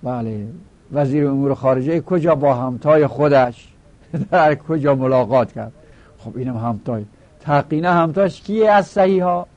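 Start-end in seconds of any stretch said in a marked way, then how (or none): 0.9-5.7 louder, half-joking newsreader tone with a laugh; a hint of a news anchor; mock news read. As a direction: play at reading a headline.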